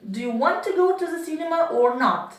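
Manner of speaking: quick, pushy either-or question; impatient question. Shown as a demonstration. A teacher showing her class an impatient question. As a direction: fire it off as if tired of waiting. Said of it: "expressed a nuance or impatience".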